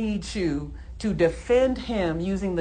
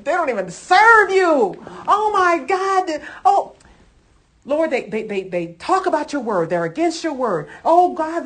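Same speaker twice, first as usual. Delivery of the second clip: higher and louder, an overwrought plea to the Lord; hammed-up desperate prayer; 7 semitones higher, 7 dB louder than usual. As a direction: wail it like a worked-up church lady complaining to God. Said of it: "She looks like she's practiced that".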